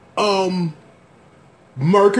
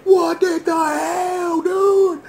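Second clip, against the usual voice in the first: high, slow, whiny cry; retold disbelief; 13 semitones higher, much slower than usual. Recalling his shock at the healing, high slow cry. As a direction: drag it out, aghast. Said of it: "I'm like".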